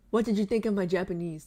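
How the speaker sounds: normal talking voice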